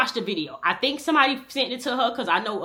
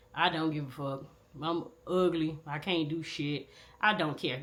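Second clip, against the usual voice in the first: numb and down; 4 semitones lower, 7 dB quieter than usual. Retold or imitated. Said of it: "This was literally how I was feeling".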